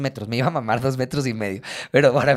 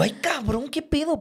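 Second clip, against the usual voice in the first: high, breathy startled exclamation; high startled gasp of protest; 13 semitones higher, 5 dB quieter than usual. Retold or imitated. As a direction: jump and squeak out a shocked protest. Startled reaction to the insult.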